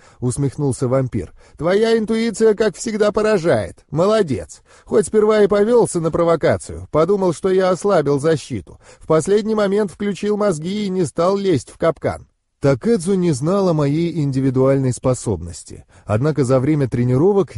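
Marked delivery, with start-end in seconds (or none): 1.6-12.3 higher-pitched mentor's teasing praise; a hint of a wry vampire mentor; lightly voiced vampire mentor, amused. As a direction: praise the pupil with a lightly mocking edge.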